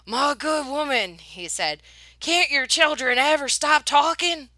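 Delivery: fed up with the chatter; fussy man demanding silence; exasperated gentleman. Acted. Hooper exasperated.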